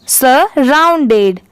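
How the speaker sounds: Surrounded slow, split as "sa, round-ded"; slow syllable demo. Shown as a demonstration. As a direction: draw out surrounded for a learner. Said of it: "तो पूरा क्या हो जाएगा". (Surrounded धीरे, हिस्सों में)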